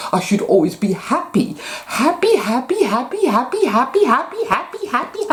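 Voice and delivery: high, fast, sing-song parody of a forced-happy believer; mocking new age voice. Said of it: "fake new age bollocks".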